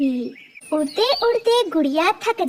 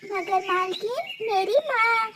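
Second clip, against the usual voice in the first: pleading little bird; 6 semitones higher, 4 dB quieter than usual. Acted. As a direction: beg quietly for your sick mother.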